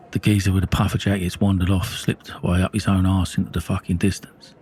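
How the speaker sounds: ordinary delivery, nothing marked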